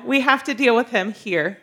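ordinary talk, nothing marked

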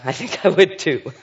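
chuckling through the words; a light real laugh while speaking. Real, not acted. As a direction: laugh it out, amused. Laughing at Mary's troubled reaction.

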